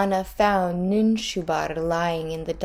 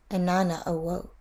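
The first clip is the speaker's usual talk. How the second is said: ordinary delivery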